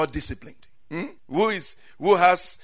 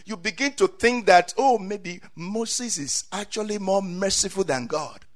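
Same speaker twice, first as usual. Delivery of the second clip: usual speaking voice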